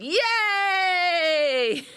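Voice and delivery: high joyful cheer. Shown as a demonstration. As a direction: cheer with arms up. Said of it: "hands above our head and go".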